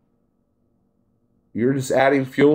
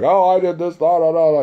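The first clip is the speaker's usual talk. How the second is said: lightly voiced driver chatter, pitched higher; imitated bragging driver; 6 semitones higher, 3 dB louder than usual. Driver talking to another driver at the counter, imitated chatter. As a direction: mimic a driver running on about himself.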